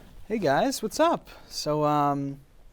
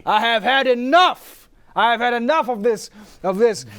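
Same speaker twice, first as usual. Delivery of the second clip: louder, higher, fed-up outburst; 9 semitones higher, 10 dB louder than usual. Acted. Poe fed up with the radio show. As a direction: boil over with theatrical outrage. Poe losing his temper.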